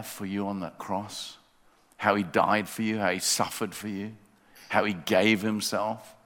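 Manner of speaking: loud, high urgent appeal to come forward; rising revival-style call to the congregation; fervent preaching. Acted. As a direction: press the question home with full preacher force.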